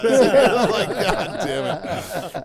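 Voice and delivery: laughing. Real, not acted. Friend recalling the prank, laughing hard.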